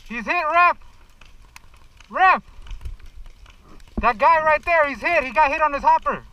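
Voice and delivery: loud calls to the referee; yelling from the sidelines. Real, not acted. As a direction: shout it across the field. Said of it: "calling hits to ref".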